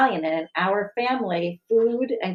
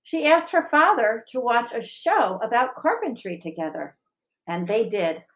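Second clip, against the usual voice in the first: gentle picture-book reading, even and clear; story narration; 4 semitones higher than usual. A narrator's reading. Teacher reading a picture book to the school, gentle narration. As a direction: read it like storytime for children.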